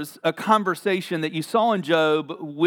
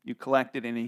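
ordinary delivery

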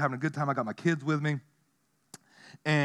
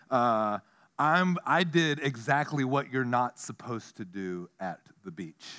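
ordinary delivery

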